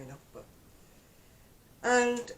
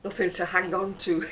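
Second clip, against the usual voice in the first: mock panic; 8 dB louder than usual. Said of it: "And I'm like".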